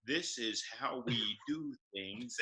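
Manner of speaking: slow, over-careful words; slow careful diction. Retold or imitated. Practising speech alone in the mirror, slow careful diction. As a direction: spell each word out slowly. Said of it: "standing in the mirror going".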